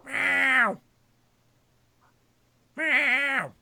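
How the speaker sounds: high, drawn-out meow; mock cat meow. Imitating a cat perched on the beanbag, meowing high. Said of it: "Pounce up there going like".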